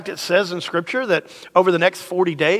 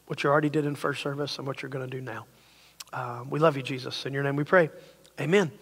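low, soft spoken prayer; 5 semitones lower, 8 dB quieter than usual. Real, not acted. Pastor praying to God before the message, soft prayer. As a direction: pray quietly and intimately.

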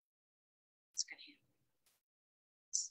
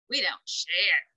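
low growl at the animals; growled line; 5 semitones lower, 4 dB louder than usual. A narrator's reading. Bully goat growling at the other animals. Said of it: "Tough growled".

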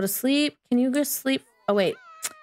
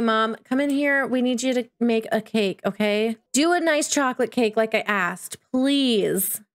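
ordinary talk, nothing marked

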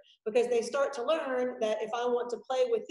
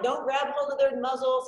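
ordinary talk, nothing marked

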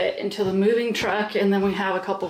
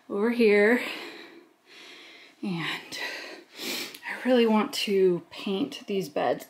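usual speaking voice

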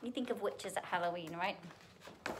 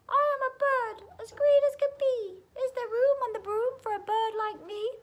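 very high, loud bird voice; shrieking bird; 9 dB louder than usual. A narrator's reading. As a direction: squawk it out as a shrill little bird. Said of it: "with an ear-splitting shriek".

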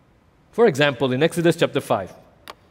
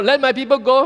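Moses demanding; 11 semitones higher, 4 dB louder than usual. Acted. Moses delivers demand to Pharaoh.

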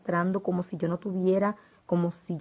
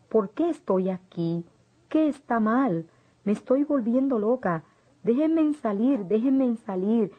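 panicked scream; 5 semitones higher than usual. Acted. Locked in the cell, screaming at the demons to release her. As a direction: beg to be let out, losing control. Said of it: "les grité".

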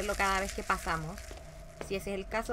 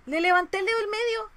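loud, high indignant outcry; 10 semitones higher, 8 dB louder than usual. Real, not acted. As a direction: protest loudly, pitched way up. Protesting at the game after dying.

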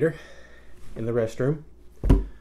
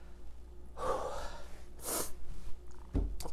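long, slow unvoiced sigh; long pained exhale; 10 dB quieter than usual. Real, not acted. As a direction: breathe out the burn. Pepper burn aftermath.

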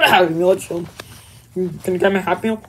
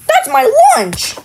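much higher, louder, slowed shocked cry; shocked outburst; 4 dB louder, slower than usual. Acted. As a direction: blurt it out in disbelief. Mario realizes his lunch given away.

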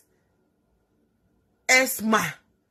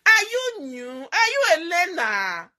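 mocking taunt; 6 semitones higher, 3 dB louder than usual. Real, not acted.

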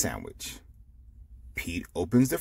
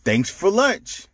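higher, louder happy thank-you; grateful friends; 11 semitones higher, 5 dB louder than usual. Acted. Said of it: "Pete's friends say".

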